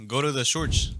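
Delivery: imitated Spanish-accented English; uncle's Spanish accent. Friend quoting his uncle's saying, Spanish accent. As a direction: say church as "shorch", Spanish accent. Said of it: "he says it with his accent".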